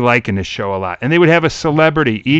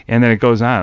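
ordinary delivery

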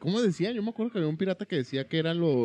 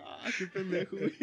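laughing; 5 semitones higher, 6 dB quieter than usual. Real, not acted. Laughing remark.

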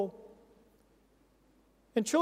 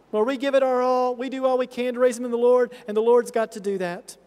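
ordinary talk

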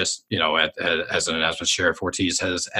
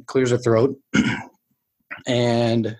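normal talking voice

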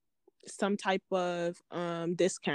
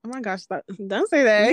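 mock shy denial; 9 dB louder than usual. Real, not acted. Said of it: "You're making me blush".

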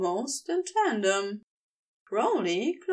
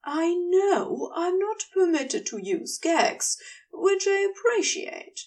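Gabriel's voice; 7 semitones higher than usual. A narrator's reading. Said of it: "Gabriel said".

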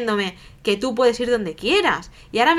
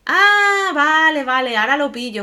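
much higher and louder, indignant outburst; angry raised voice; 6 semitones higher, 4 dB louder than usual. Retold or imitated. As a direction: snap back at him, voice climbing high and loud. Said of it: "alzo el tono y es que me cabreé". (tono alzado y cabreado, mucho más agudo y fuerte)

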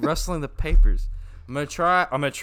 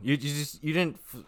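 normal talking voice

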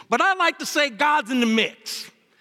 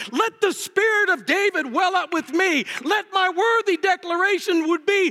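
raised, fervent pulpit voice; fervent preaching; 6 semitones higher than usual. Acted. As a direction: build the appeal higher, preaching. Preacher's rising scripture appeal.

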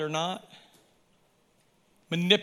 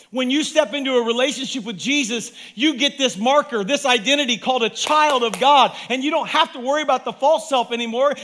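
loud, high, excited preaching; excited yelling; 6 semitones higher, 5 dB louder than usual. Real, not acted. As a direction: shout it out with joy. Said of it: "I'm yelling 'cause I'm excited".

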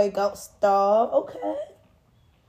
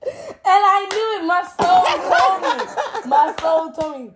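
laughing; 10 semitones higher, 8 dB louder than usual. Real, not acted. Gloating, laughing, half singing.

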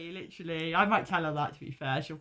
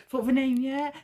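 higher-pitched, chirpy nurse question; raised questioning lilt of a visiting nurse; nurse checking in; 7 semitones higher than usual. Retold or imitated. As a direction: lightly imitate a nurse popping in to ask. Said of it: "every time the nurse would come around".

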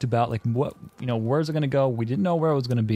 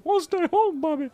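very high whiny mock sob; whiny crying. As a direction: whine it like a crying kid. Co-host mocks the whine.

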